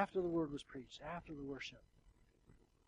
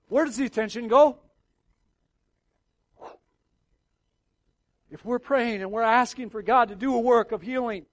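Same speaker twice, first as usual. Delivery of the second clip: higher, much louder fervent preaching; preaching at full force; 9 semitones higher, 16 dB louder than usual. Acted. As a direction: thunder it from the pulpit.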